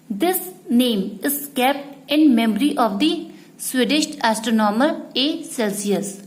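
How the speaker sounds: usual speaking voice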